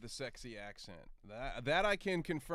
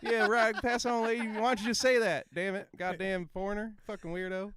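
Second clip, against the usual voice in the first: high, loud mock exasperation; irritated border officer; 7 semitones higher, 7 dB louder than usual. Border guard scolding grandmother, hammed-up irritation. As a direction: mimic a fed-up guard waving her through. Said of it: "And they're like".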